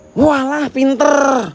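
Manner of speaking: high, sarcastic "pinter"; mock praise. Real, not acted. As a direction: scoff at the silly idea with fake praise. Mocking the statue belief.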